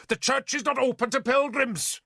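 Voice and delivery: high, sharp snap at the intruder; snapping bishop. Acted. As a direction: bite the words off. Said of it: "the bishop snapped".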